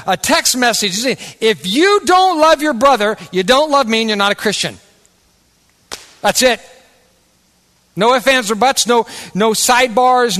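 loud, high, driving sermon delivery; impassioned pulpit build; full-force preaching. Acted. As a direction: preach it hard to the congregation.

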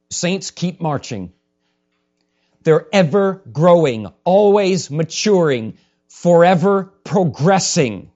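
loud, measured triad, each phrase driven home; forceful preaching. Real, not acted. Preacher describing saints who keep marching, loud and emphatic. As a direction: proclaim it to the congregation.

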